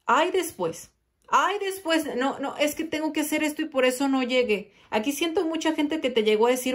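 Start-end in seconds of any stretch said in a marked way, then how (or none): 0.0-4.7 a lightly voiced whiny excuse, higher; excuse-making. A person dodging a visit, lightly mimicked whiny excuses. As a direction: brush it off with flimsy excuses. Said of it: "pone muchos pretextos".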